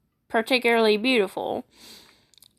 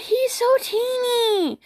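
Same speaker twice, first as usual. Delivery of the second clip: cooing squeal; 12 semitones higher, slower than usual. Real, not acted. Gasping at a tiny kitten.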